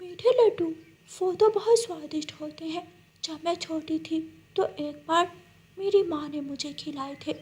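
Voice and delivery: a hint of a dreamy goat; eager goat. Goat Sweeto, tempted by the promise of sweets.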